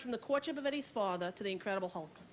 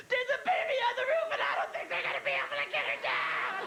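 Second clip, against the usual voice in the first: high, loud, urgent; high panicked late-night call; frantic phone report; 11 semitones higher, 3 dB louder than usual. Retold or imitated. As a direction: blurt it out panicked. Calling sister about TV rescue.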